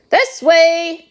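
high, loud call to follow; urgent cry. A narrator's reading. Wonka leads the party off the stairs, high loud cry. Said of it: "cried Mister Wonka".

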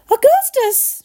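high, loud motherly shout; sharp high-pitched call. A narrator's reading. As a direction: shout the name high, falling off. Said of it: "shouted Missus Gloop".

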